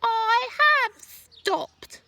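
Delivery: put-on high, loud, very slow old-woman voice; smug grandma calling down, slow and loud; Grandma answering. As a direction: drag it out triumphantly from the rooftop. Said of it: "she answered".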